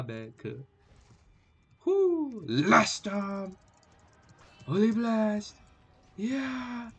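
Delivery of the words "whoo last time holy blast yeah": hyped callouts. Real, not acted. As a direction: hype up each attack. Calling out combat moves.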